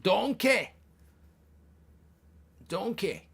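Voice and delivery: high, punchy Scottish ogre call; Shrek. Acted. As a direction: call out in a mock Scottish accent. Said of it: "Shrek's Scottish".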